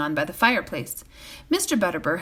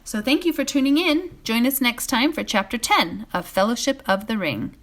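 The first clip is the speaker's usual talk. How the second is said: higher, louder host outro; sign-off; 5 semitones higher, 4 dB louder than usual. Real, not acted. The host signing off to Flagstaff listeners, upbeat. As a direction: warm broadcast goodbye.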